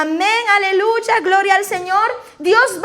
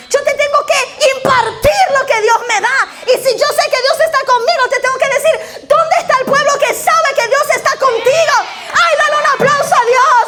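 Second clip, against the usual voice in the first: fervent preaching; 4 semitones higher than usual. Acted. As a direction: pour out the joy, nearly shouting. Preaches with rising fervour.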